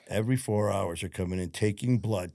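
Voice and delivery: ordinary talk, nothing marked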